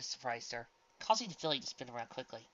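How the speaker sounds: ordinary delivery, nothing marked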